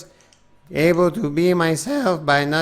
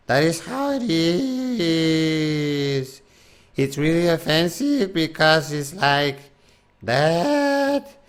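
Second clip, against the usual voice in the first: Slowpoke Rodriguez impression. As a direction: drag it out like a sleepy cartoon mouse. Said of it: "doing this impression".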